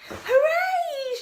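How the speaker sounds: high joyful cheer; boy cheering as he finds the monster; excited shout. Acted. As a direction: shout it with glee. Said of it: "shouted George".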